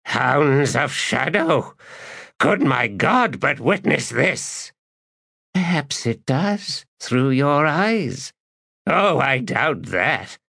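character voices. A narrator's reading. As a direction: voice the priest and spirit. Priest and Shadow Throne voiced.

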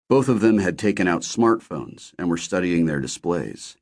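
ordinary delivery, nothing marked

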